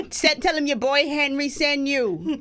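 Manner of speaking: slow, high, hammed-up fake Bahamian accent from an old furniture ad; a lightly mocking bad Bahamian accent. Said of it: "the worst Bahamian accent ever".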